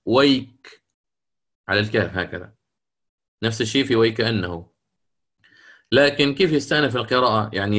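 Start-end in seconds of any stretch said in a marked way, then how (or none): 0.1-2.5 ويك cut short on the kaf; stop on the kaf. Shown as a demonstration. Teacher demonstrates Abu Amr stopping at the kaf, clipped. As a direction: end ويك sharply on the kaf. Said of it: "يقف عليها بالكاف".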